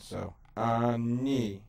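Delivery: ani drawn out slowly, ni gliding into a Y; slow Hebrew demo. Shown as a demonstration. Teacher demonstrates the diphthong in ani, very slow. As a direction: stretch ani out for the learner. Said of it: "Ni sort of bumps into a Y sound".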